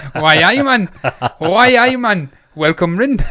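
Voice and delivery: loud, high, hammed-up Geordie; mock Geordie accent. Co-host hamming up his accidental accent, exaggerated Geordie. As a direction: belt out a playful Geordie greeting. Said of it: "I'm doing Geordie again".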